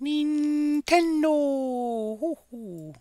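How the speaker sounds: high, drawn-out falsetto coo; falsetto call. Pretend. Streamer calling for the Nintendo show to start, high falsetto coo. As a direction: sing out the name softly and very high.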